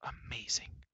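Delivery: hushed, whispery wonder; amazed exclamation. A narrator's reading. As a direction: marvel under your breath. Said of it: "exclaimed the prince".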